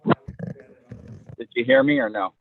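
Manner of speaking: loud call across the room. Real, not acted. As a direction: raise your voice to reach them.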